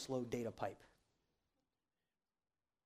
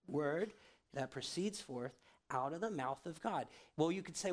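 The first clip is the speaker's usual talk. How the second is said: ordinary talk, nothing marked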